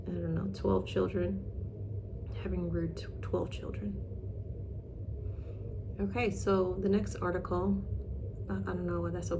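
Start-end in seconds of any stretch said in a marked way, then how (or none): none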